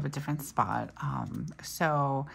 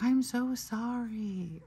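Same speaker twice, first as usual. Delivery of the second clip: high, drawn-out mock yelled apology; retold yelled apology; 8 semitones higher, much slower than usual. Mom imitating her son's apology to a stranger, mock yell. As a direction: imitate a kid calling out sorry, pitched high. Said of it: "And he yells".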